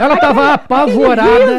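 high, loud voice full of laughter; real laughter breaking into the words; laughing retelling. Real, not acted. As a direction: tell it while cracking up. Retelling own fit of laughter.

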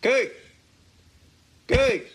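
calling out over a dropped call; bad connection call. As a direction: fake lagging phone call. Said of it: "You pretend you're frozen?".

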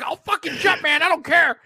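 high, laughing fake despair; mock desperate yell. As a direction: ham up a man on a ledge. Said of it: "He's like".

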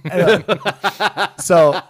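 loud laughing through the words. Real, not acted. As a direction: burst out laughing mid-word. Laughs loudly.

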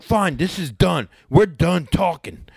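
high-pitched, fed-up cutoff; worked up, slowing on the last words; retold angry dismissal. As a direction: act out ending a quarrel. Friend ends retold argument.

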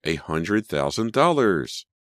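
drawn-out astonishment, falling at the end; awed exclamation. A narrator's reading. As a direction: stretch it out and swell on DOLLARS. Repeats the sum in amazement.

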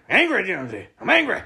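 "angry" flung out high; mock anger. Shown as a demonstration. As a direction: let the word angry jump way up in fake rage.

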